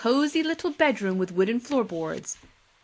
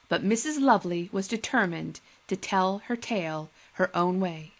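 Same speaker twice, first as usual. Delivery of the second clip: usual speaking voice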